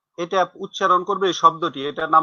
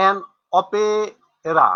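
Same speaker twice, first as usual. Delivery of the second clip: an opera drawn out very slowly with weight on an; stretched teaching example; slow stressed example; much slower than usual. Shown as a demonstration. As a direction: lean on an, then stretch out opera. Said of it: "teacher demonstrating article use".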